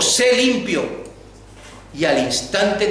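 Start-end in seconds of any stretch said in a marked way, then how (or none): 0.0-1.1 very slow, high, deliberate; solemn command. Retold or imitated. Jesus heals the leper, slow solemn word. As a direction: pronounce the healing word gently but firmly. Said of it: "Al leproso le dijo".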